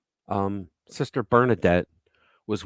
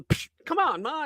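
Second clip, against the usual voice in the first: put-on much higher, kid's protesting whine; whiny kid protest; 4 dB quieter than usual. Boy protesting to his mother while being slapped, high whine. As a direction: pitch it up like a wronged kid pleading.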